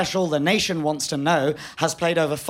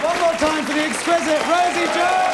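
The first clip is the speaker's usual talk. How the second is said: high, projected announcer shout; rousing host call; 9 semitones higher than usual. Real, not acted. Host cheering a comedian off stage, rousing call. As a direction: whip up applause for the act.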